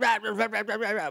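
high, mumbo-jumbo Southern babble; Southern-accented gibberish. Retold or imitated. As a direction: imitate garbled Southern cartoon talk. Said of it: "but, like, with a southern accent".

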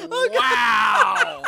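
loud high laughing wow; shocked shout. Real, not acted. Reacting to instant death.

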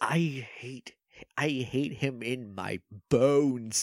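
low, slow, quiet disgust; weary, seething declaration; deep loathing. Real, not acted. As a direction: let the hatred out slowly and softly. Declares hatred of the character.

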